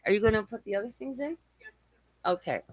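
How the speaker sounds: soft, quick off-mic aside. Real, not acted. Mid-rant, the streamer turns to ask her kid something quietly. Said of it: "Sorry, it's my kid".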